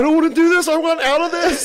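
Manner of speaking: very high, loud, laughing panic; falsetto mock panic. Mimicking a panicking friend on drugs for his buddy. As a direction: squeal it like a freaked-out friend. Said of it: "came down and was like".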